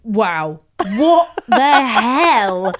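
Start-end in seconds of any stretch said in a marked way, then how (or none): none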